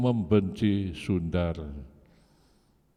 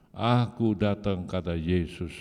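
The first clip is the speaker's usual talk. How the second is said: normal talking voice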